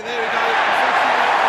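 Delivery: huge, slow, drawn-out roar; goal celebration. Real, not acted. Fan watching the match, roaring at the goal. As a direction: bellow it out as the goal goes in.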